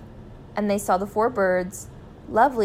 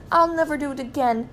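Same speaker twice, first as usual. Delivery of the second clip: high, earnest promise under threat; pleading cry; 6 semitones higher than usual. A narrator's reading. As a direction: cry it out like a man begging for mercy. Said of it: "cried Mister Gregg".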